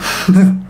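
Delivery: short anxious giggle; a quick nervous titter; nervous laugh. Real, not acted. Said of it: "See? I just did it. That's that nervous laugh".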